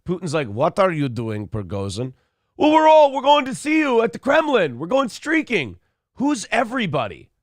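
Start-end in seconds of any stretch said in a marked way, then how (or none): 0.5-2.1 low, dropping bewildered question; lightly voiced Putin, baffled; a hint of puzzled Putin. Putin asking Prigozhin, lightly voiced and puzzled. 2.6-5.8 put-on high, loud party-boy glee; gleeful streaking announcement. Prigozhin telling Putin about the Kremlin, gleefully high. Prigozhin voiced, Old School reference.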